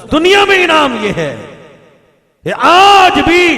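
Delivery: rising sermon cry, pushing each phrase; impassioned preaching. Real, not acted. Preacher stirring the congregation, impassioned proclaiming. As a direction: preach it out with conviction.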